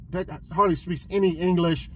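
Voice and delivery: ordinary delivery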